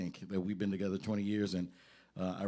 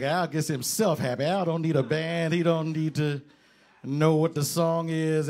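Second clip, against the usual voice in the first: louder, building hype about Al; excited praise; 4 semitones higher, 8 dB louder than usual. Retold or imitated. Bandleader hyping up the drummer to the crowd, excited. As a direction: preach it like a revelation.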